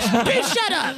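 high-pitched comic snap at a heckler; mock angry retort. Host to a woman in the crowd, mock angry. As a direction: imitate an exasperated host, pitch leaping up.

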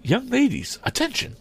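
high-pitched call to the class; calling teacher. A narrator's reading. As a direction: call the pupils to order in a high voice. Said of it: "called Miss Delafield".

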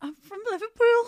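nervous go at a Scouse voice; Scouse accent. Shown as a demonstration. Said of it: "Do I have to".

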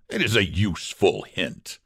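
ordinary delivery, nothing marked

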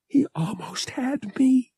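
breathy unvoiced whisper; hushed frightened whisper. A narrator's reading. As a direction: whisper it in shock. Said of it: "she whispered".

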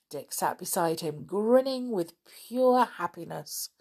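plain reading voice

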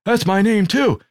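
high, put-on glad-to-meet-you voice; mock delighted introduction, high-pitched; John Laurinaitis. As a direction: imitate an oblivious colleague, pitched well up. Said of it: "he goes".

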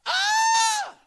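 loud, high-pitched startled scream; one long piercing shriek of alarm. Retold or imitated. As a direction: shriek as if caught off guard. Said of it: "she screamed".

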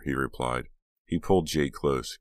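ordinary delivery, nothing marked

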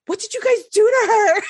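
high, concerned stranger voice; mock alarmed stranger asking what happened; nosy lady. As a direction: play the worried lady who butts in. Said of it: "this lady was like".

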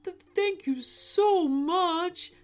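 high, slow, stammering thanks; grateful donkey. Acted. Donkey thanking alien for gift, high stammering gratitude.

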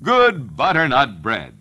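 ordinary talk, nothing marked

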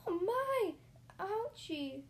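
high, loud little cry; squealed shock from a bystander; shouting onlooker. A narrator's reading. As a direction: exclaim in a high crowd voice. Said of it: "Everyone was shouting".

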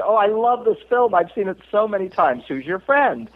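gushing fan, voice lifted high; excited ticket taker. Retold or imitated. Ticket taker gushing to Scott about the film, excited. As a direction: sound thrilled to meet a fan of the film.

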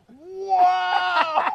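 loud, high, comic disbelief; hammed-up outraged astonishment; shocked. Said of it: "so shocked".

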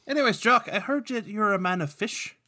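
high, put-on Irish brogue; [name]'s Irish accent. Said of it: "Speaking of bad accents".

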